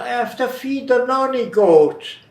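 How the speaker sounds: put-on high, loud Cockney old man; Cockney imitation. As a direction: give it the full Cockney twang. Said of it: "I wanted to get that dialect real".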